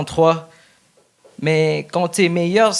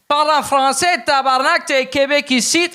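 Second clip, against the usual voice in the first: put-on Québécois accent; 9 semitones higher than usual. Immigrant mocks a Quebecer telling immigrants to speak French. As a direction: bark it like an angry Quebecer. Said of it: "l'accent québécois".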